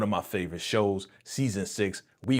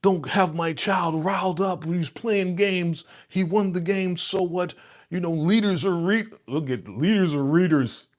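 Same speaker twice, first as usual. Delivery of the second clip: mocking Orlando the scientist, prim and preachy; stiff bookworm Orlando; 7 semitones higher, 3 dB louder than usual. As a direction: lecture like an uptight scholar. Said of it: "guys that's like".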